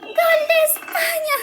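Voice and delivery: high, slow shout through laughter; laughing goal shout. Acted. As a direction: crow over the goal. Celebra gol de España. (grito de gol entre risas)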